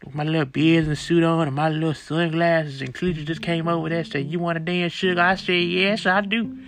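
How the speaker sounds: put-on sassy old church lady voice; Sister Maddie Jenkins. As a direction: play a loud, gossipy elderly Lakers fan. Comedy character podcast guest.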